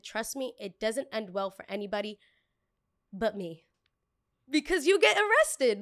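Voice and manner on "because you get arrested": emphatic. Real, not acted. Emphatic aside.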